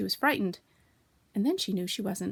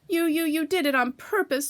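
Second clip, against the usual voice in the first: higher, stammering splutter; 8 semitones higher, 4 dB louder than usual. A narrator's reading. Piglet with soap in his mouth, spluttering. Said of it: "spluttered Piglet".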